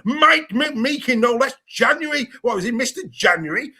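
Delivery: teasing high-pitched astonishment; mock amazement. Real, not acted. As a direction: marvel at it, pitched well up. Host marvels at squad number list.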